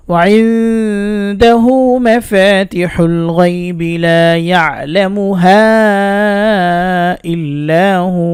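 slow, drawn-out tilawat; measured Quranic recitation, long held notes. Acted. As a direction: chant the verse, stretching every vowel. Recites the verse slowly.

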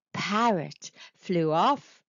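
ordinary delivery, nothing marked

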